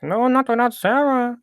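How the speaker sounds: high, defiant refusal in a put-on voice; mock defiant Danny. Friend acting out Danny refusing to leave, high and defiant. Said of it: "And Danny's like".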